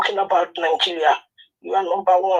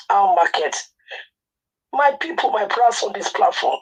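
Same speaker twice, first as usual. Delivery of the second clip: ordinary delivery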